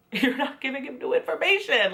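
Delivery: laughing repeat. Shown as a demonstration. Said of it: "repeated with laugh".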